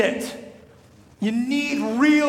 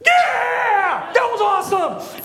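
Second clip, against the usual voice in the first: high, loud mock cheer; 11 semitones higher, 5 dB louder, slower than usual. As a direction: whoop it like teens who got away. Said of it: "we're like".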